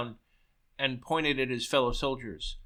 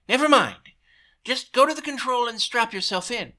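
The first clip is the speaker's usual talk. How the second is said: hasty cover-up; 9 semitones higher, 5 dB louder than usual. Acted. Goebbels covering a lie.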